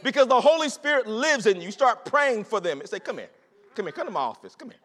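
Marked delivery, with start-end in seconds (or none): 3.0-4.8 soft, hushed, caring invitation aside; gentle quiet call. Retold or imitated. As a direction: draw them in quietly, like a friend. Pastor calling hurting coworker aside.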